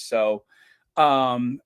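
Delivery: ordinary talk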